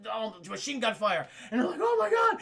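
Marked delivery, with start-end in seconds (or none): 1.8-2.4 high, loud alarm; shocked cry. Retold or imitated. People under machine gun fire, shocked cry. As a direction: cry out in horror. Crowd screams.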